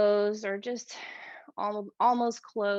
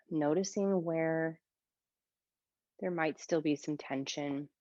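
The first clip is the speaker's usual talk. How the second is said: calm yoga teacher voice with long pauses; guided meditation; 5 semitones lower than usual. Shown as a demonstration. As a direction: ease them into stillness, leaving space between phrases. Leads breathing meditation in yoga class.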